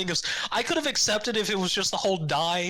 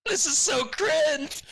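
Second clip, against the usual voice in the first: laughing disgust; 4 semitones higher, much slower than usual. Retold or imitated. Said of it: "And I'm just like".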